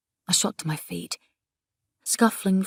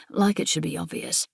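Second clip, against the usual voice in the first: ordinary delivery, nothing marked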